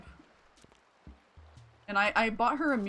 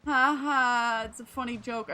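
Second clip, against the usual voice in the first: sarcastic; 4 semitones higher, 6 dB louder than usual. Real, not acted. Gamer mocking a tired villager joke, sarcastic. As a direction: fake laugh, then dry sarcasm. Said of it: "I never thought it was funny".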